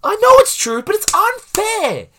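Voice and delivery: high, loud indignant cry, slowing; outraged protest. Real, not acted. Coworker protesting to his cohost, high outraged cry. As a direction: wail the unfairness.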